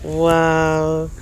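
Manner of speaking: normal talking voice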